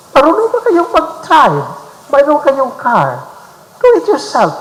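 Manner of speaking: high, indignant talking back; mock the rebel who refuses the demand; defiant jackal answering a demand; rebellious retort. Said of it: "ang reaction sa jackal ay rebellion".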